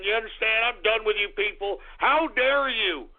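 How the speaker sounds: ordinary talk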